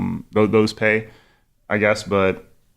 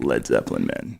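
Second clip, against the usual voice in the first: much lower, mock rock-star voice; deep rocker voice; 11 semitones lower, 6 dB quieter than usual. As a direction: drop low like a cool rock dude. Joking impression of rock band.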